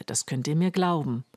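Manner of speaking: ordinary delivery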